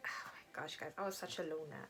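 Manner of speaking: embarrassed whisper to the viewers; soft, breathless admission; whispered confession. Real, not acted. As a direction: whisper it like a guilty secret.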